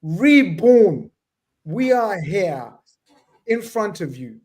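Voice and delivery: loud, drawn-out ring-announcer call; big high-pitched emcee opening; show announcer intro. Acted. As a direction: announce the show grandly, stretching each word.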